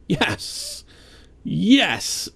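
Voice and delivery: triumphant yes on a first-try success; excited cheer. Real, not acted. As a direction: celebrate the perfect fit. Terrain piece connects perfectly.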